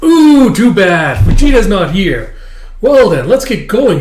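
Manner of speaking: loud, high, gloating singsong; mocking taunt. Kidnapper to the girl he grabbed, gloating. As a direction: taunt the victim with mock sympathy.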